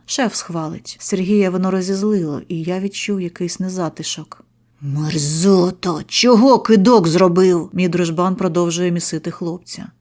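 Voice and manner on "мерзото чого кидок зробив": loud, slow furious yell; enraged Sergiy. A narrator's reading. Sergiy raging at the silent victim. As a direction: spit the insult then yell.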